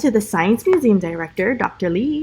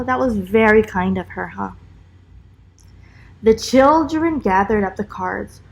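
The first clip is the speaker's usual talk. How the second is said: ordinary delivery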